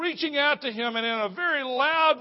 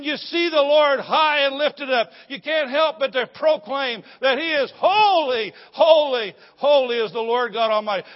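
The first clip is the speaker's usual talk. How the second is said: loud, high proclaiming; full-force preaching; 5 semitones higher, 7 dB louder than usual. Acted. Preacher crying out holy to the congregation. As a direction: preach it out with fire.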